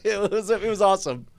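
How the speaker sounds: ordinary delivery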